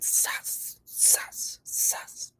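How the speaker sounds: breathy whispered sus, sus, sus. Pretend. Commentator whispering to the audience, breathy whisper. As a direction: hiss it under your breath.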